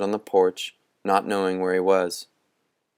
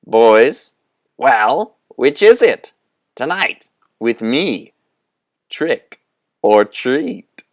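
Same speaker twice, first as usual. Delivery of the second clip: loud, rising, much higher calling-out; final shout; 5 semitones higher, 8 dB louder, slower than usual. A narrator's reading. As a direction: call after the boys across the house. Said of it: "a final shout from Moundshroud".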